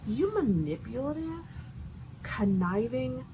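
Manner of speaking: slow, drawn-out condemnation; slow disgust. Real, not acted. Reader condemns the doctor for using Luke, slow disgust. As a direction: let each insult drag out with contempt. Scolding the character she just mimicked.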